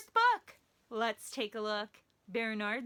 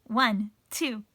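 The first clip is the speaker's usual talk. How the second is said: plain reading voice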